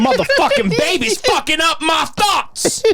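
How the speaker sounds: slow, high-pitched exasperated imitation; mock angry outburst. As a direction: burst out in hammed-up outrage, drawing it out. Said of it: "at a point where he's like".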